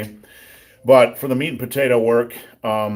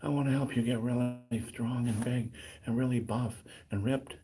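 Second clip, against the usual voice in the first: much softer, barely projecting; timid mumble; 15 dB quieter than usual. Shown as a demonstration. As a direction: say it meekly as if afraid to be heard. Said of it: "you don't wanna talk like that".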